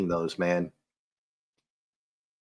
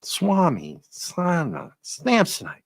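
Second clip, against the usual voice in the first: Dumb and Dumber quote; 10 semitones higher, much slower than usual. Retold or imitated. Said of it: "Dumb and Dumber".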